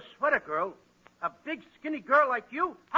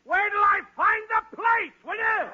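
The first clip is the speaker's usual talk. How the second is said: exasperated outburst; 7 semitones higher than usual. Acted. Exasperated outburst at the stalling witness, shouted. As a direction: shout it high, dragging the words.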